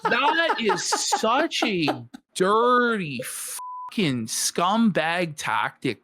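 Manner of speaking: each word dragged out slowly in disgust; a slow, contemptuous drawl; slow, disgusted emphasis. Real, not acted. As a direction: spit the words out slowly, like you can't believe it. Angry callout of a tactic.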